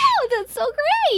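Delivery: high-pitched saleswoman gush; high, effusive and put-on; gushing delight. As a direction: coo with overdone delight. Trish gushes over thanks.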